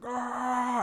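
a sudden high mock wail of grief; anguished cry.

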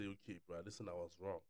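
low, soft acted climb-down; mock a man backing down, low and quiet; humbled apology. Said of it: "bow down and say".

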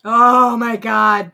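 loud, very slow, drawn-out oh my God; exasperated groan. Real, not acted. Hearing Super Mario Brothers on the list, loud drawn-out groan. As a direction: groan it out in disbelief.

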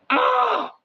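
a high, loud "ah!" letting off steam; sharp yelp of exasperation. Real, not acted. Vlogger blurting a high cry to viewers after a rant.

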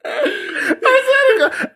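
very high, slow laughing wail; crying with laughter. Real, not acted. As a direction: squeal it out, helpless with laughter. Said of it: "I'm crying over here".

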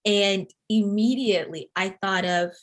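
ordinary delivery

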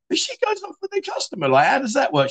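high, indignant squeak; squeaky mock indignation; indignant protest. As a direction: protest like a wronged customer. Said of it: "And I'm like".

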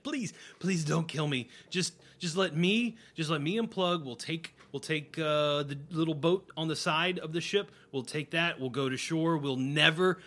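begging for my life. Acted. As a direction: beg for mercy, frantic. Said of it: "pleading for his life".

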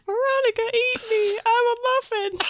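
high-pitched joke muffin voice; talking muffin. Pretend. Muffin calls Veronica from the other room, high silly voice. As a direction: pipe up high as a pretend muffin.

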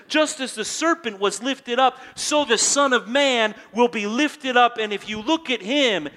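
loud, high proclamation of the quote; preacher's full-voice declaration; loud preaching. Acted. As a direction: ring it out over the room. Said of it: "And Jesus says".

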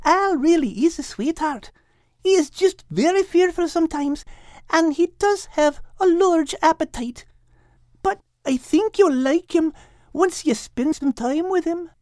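a light motherly cat clucking over a mess; Kate, gentle. Acted. Kate asking the others to wake Al gently. Kate's voice.

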